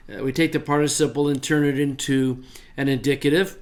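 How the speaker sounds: ordinary talk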